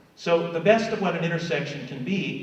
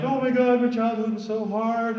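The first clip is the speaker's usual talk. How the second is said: crying; 9 semitones higher, 6 dB louder than usual. Retold or imitated. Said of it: "a lot of people are crying".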